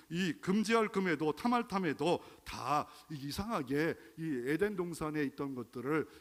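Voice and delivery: ordinary talk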